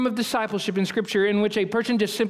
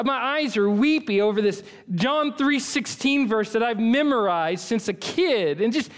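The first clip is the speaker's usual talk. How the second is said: ordinary delivery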